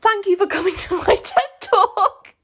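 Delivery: high, loud, breaking into laughter; giddy mock thank-you to an audience; laughing sign-off. Real, not acted. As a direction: wrap up the rant through giggles. Reader jokingly closes their rant.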